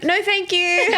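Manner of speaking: high, drawn-up mock-polite refusal; a high-pitched, airy no-thanks; polite brush-off. Woman imagines turning away a stranger at her door, mock-polite brush-off.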